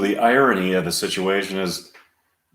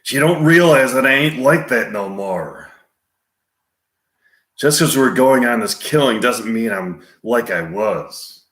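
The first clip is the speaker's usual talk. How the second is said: Clint Eastwood. Acted. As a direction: confide a hard past, with long pauses. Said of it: "Clint Eastwood continuing".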